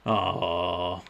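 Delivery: a flat, soft, uncaring "that's a shame"; insincere sympathy. Retold or imitated. Quoting a villain who shrugs off a loss, insincere and soft. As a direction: pretend to care but clearly don't. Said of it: "not giving that much of a shit".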